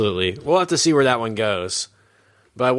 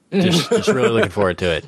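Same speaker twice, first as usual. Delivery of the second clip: deadpan sarcasm. Acted. Sarcasm about bad show.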